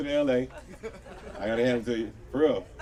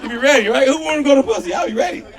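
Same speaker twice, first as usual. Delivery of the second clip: much higher, louder eager shout; pumped-up yell; excited hype; 9 semitones higher, 9 dB louder than usual. Real, not acted. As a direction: whip the crowd into it. Hypes crowd about trip.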